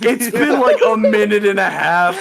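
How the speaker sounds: high, loud, worked-up exclamation; incredulous protest. Real, not acted. Player disputes the three-minute timer with host, loud incredulous protest. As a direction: protest the timing at the top of your voice.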